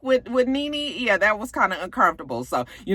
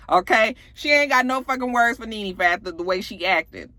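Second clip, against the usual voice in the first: ordinary delivery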